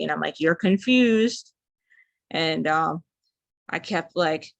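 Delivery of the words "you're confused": high, loud and slow; raised, slowed yell at a confused man; retold yell. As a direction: yell it to get through to him. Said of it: "yelling at him to stay awake".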